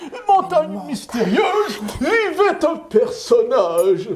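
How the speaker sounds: put-on excited story narration for kids; storytelling. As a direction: tell it like a wide-eyed tale for children. Père Noël raconte le conte. (conteur enthousiaste pour enfants)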